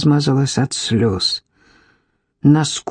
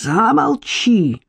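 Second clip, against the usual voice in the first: slow, higher cry for silence; shouted command; 4 semitones higher, slower than usual. A narrator's reading. Alex orders Irka to stop accusing him, drawn-out angry cry. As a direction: stretch out the order, pitch raised. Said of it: "закричал Алекс".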